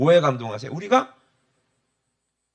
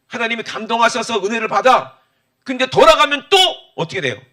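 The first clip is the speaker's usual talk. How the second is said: high, loud impassioned sermon climax; full-force preaching; 8 semitones higher, 12 dB louder than usual. Acted. Pastor urging the congregation, loud high impassioned preaching. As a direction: preach it hard, voice ringing high.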